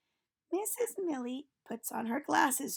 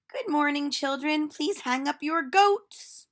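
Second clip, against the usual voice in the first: put-on a cheerful teacher calling out to children; silly teacher voice; 5 semitones higher, 7 dB louder than usual. Teacher Missus Millie greets class.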